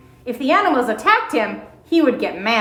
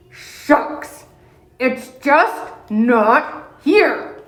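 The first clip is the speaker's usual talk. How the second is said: put-on grumbling old bear; much slower than usual. Old bear grumbling to himself at the door, slow and tired. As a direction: drag it out like a sleepy old bear. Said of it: "he grumbled".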